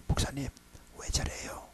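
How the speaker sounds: whispered question to the pastor; hushed aside. Retold or imitated. Asking the pastor in a hushed voice. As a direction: lean in and ask under your breath.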